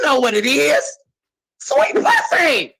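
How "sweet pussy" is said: crying shout. Real, not acted. Answering her friend's question, a high crying shout. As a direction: wail the name out.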